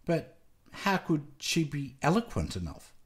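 ordinary delivery, nothing marked

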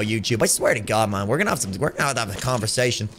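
ordinary talk

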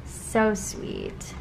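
breathy whisper of delight; hushed adoring whisper; whispered gush. Real, not acted. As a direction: whisper it, overcome by how sweet it is. Cooing over an old spread.

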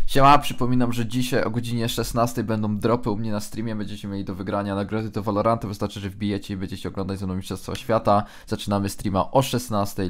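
upbeat streamer ad read; energetic stream intro. Acted. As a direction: pitch the stream like an announcement.

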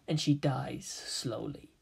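soft, slow unvoiced menace; whispering a threat at the spy; whispered threat. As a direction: breathe the threat slowly. Teen's death threat.

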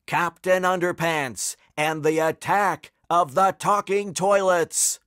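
hyped-up adventure trailer voice; trailer announcer. Acted. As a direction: announce the title big and high.

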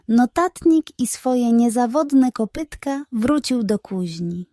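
ordinary delivery

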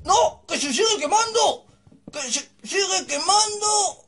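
high cries of dismay; distressed wail. Real, not acted. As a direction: wail it high, drawing out the repeat. Fire keeps burning in game. (lamento agudo y desesperado)

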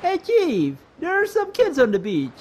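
loud, high Italian chef voice; Italian accent. Acted. Panky the chef calling to Chief about kids on the beach, Italian accent. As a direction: call out with a put-on Italian accent. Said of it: "I gotta give him the Italian voice".

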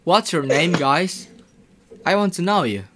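ordinary talk

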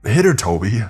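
loud urgent command; shouted order. Acted. Worker shouts orders at Toby. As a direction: bark it at Toby.

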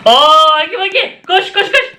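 startled high yelp, run; panicked cry to flee; being chased. Real, not acted. Hagi Wagi appears, fleeing.

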